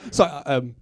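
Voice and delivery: ordinary talk, nothing marked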